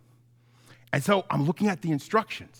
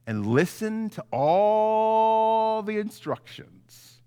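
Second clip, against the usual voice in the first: "listen to all the instructions" said very slowly and higher; stretched emphasis; 5 semitones higher, much slower than usual. Acted. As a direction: drag out "all the instructions" to drive it home. Preacher stresses key phrase.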